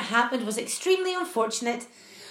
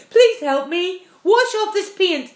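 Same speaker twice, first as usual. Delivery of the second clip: loud pleading; 6 semitones higher, 7 dB louder, slower than usual. Acted. Centipede begging for help.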